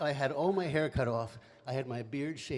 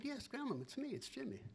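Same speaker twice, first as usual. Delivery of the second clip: put-on young self; 12 semitones higher, 7 dB quieter than usual. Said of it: "And I said".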